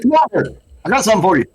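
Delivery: taunting. Acted. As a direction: lure him over teasingly. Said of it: "I said".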